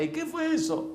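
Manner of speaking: high-pitched mock mom voice; mother's alarmed question. Mother asking children about a noise, high and alarmed.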